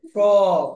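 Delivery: ফ্রগ as long "frawwg"; loud ফ্রগ with stretched falling vowel; aw sound demo. Shown as a demonstration. Teacher gives an example of the sound, ফ্রগ as drawn-out "frawwg". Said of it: "যেগুলো আছে অ উচ্চারণ".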